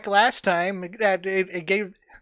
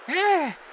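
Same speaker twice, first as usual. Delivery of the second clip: startled cry; 11 semitones higher, 3 dB louder than usual. Acted. Guard cries out.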